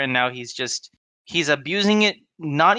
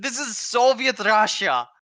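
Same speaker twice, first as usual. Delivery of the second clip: slow, higher, mock Russian; horrible Russian accent; 7 semitones higher, slower than usual. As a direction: ham up a bad Russian accent. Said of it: "I'm doing a horrible accent".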